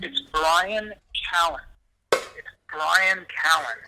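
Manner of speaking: interviewee correcting his garbled name, very slow and deliberate; pointed correction. Real, not acted. Interviewee to interviewer who got his name wrong, pointedly slow. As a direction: BRIAN CALLEN spelled out slowly, word by word. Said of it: "say this just one more time".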